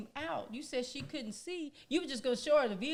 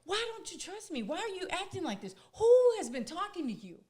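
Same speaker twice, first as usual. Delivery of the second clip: retelling his upset, higher and louder accusing questions; upset husband; 7 semitones higher, 6 dB louder than usual. Retold or imitated. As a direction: act out the husband getting worked up, pressing each question. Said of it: "Oh, he got so upset with me".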